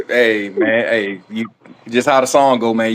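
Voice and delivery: usual speaking voice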